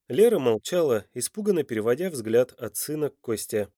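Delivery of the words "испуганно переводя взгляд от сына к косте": plain reading voice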